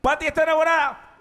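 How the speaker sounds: high, quick teasing shout; burla gritada. Pretend. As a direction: call out the tease like a fan. Teasing shout between cheers. (grito burlón, agudo y rápido)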